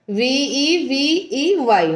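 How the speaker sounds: "ভিই ভি ই ওয়াই" spelt letter by letter, very slowly; spelling it out. Shown as a demonstration. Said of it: "তাই তো হয় মনে হয় উচ্চারণটা". ("ভিই ভি ই ওয়াই" ধীরে ধীরে অক্ষর ধরে বানান করে)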